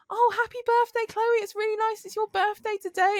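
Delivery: imitating a gushing well-wisher, very high and loud; cheery birthday wishes. Coworker wishing her happy birthday from behind, high cheery imitation. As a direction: sing out mock birthday greetings, pitched right up. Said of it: "trying to wish me happy birthday".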